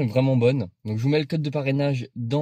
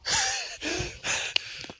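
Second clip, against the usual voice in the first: high squealing laugh; 5 dB quieter than usual. Real, not acted. Laughs at the ice.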